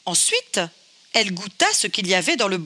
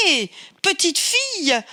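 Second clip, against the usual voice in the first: calling out; 8 semitones higher than usual. Acted. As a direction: call after her as she runs. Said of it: "lui cria Bébé Ours".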